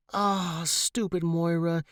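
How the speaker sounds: frustrated, scolding herself; cursing herself under breath; self-cursing. A narrator's reading. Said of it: "she cursed herself".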